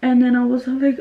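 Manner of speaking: ordinary talk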